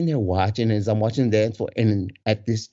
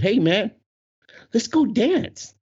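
high, drawn-out dare to a buddy; challenging; 12 semitones higher, slower than usual. Retold or imitated. Man turning to his buddy, challenging him to dance. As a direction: throw down a challenge, voice pitched up. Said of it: "like I'm challenging him now".

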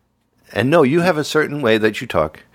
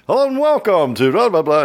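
higher-pitched hammed-up show intro; mock radio host welcoming listeners; stupid radio voice; 8 semitones higher, 3 dB louder than usual. Shown as a demonstration. Showing friend his show voice, stupid radio voice. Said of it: "stupid radio voice".

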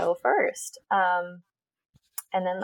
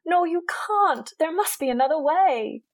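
put-on British-accented Hermione Granger; 8 semitones higher than usual. Hermione begging Ron in the play, high British plea. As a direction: plead in a theatrical British accent. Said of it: "[name] as Hermione Granger".

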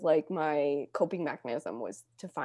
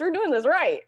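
laughing; 11 semitones higher, 7 dB louder than usual. Real, not acted. Laughing excited punchline.

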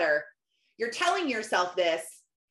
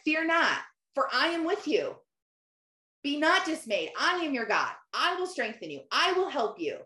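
higher-pitched, emphatic answer in short separate promises; God; 4 semitones higher than usual. Retold or imitated. God answering her doubts, imitated with firm assurance. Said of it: "God literally answered me and said".

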